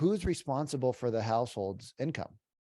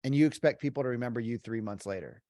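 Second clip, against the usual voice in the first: ordinary talk, nothing marked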